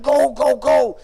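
loud, high, insistent prodding; urging. Retold or imitated. Friends sending him to the front, acted loud urging. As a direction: push him on with rapid loud bursts.

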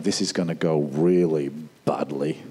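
a soldier expecting disaster; gloomy Israelite. Retold or imitated. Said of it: "going like".

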